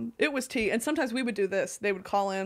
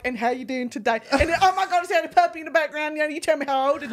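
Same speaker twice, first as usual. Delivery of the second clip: higher, fast friendly Southern chatter; bubbly Southern agent matching energy; beefed-up Southern twang; 3 semitones higher, faster than usual. Retold or imitated. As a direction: gush about the puppy with a thick Southern twang. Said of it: "I would beef it up".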